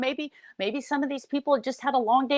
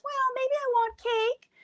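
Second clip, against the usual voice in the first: much higher, slow coy voice; mock coy voice; 3 dB quieter than usual. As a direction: play her giving in to cake, sugary. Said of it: "And then Chantal says".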